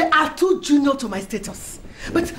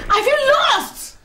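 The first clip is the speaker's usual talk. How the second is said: higher, slow, drawn-out wail of despair; stretching out feel lost in anguish; wailing lament; 9 semitones higher, slower than usual. Acted. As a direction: wail the words as if all is lost. Repeats lament to Edna.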